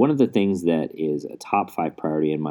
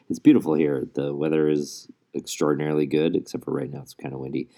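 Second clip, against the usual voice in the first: normal talking voice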